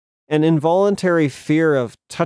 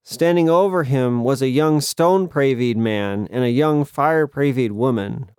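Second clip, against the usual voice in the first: plain reading voice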